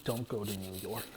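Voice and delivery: a low, hushed voice for the uncle; low and hushed, a lightly voiced uncle; uncle's low warning. Uncle warning the speaker away, low and hushed.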